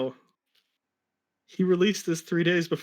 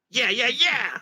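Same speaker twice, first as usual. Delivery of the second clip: cheering yeahs; 8 semitones higher, 4 dB louder than usual. Acted. Sonic cheering in fanfic.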